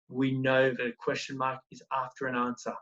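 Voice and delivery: ordinary delivery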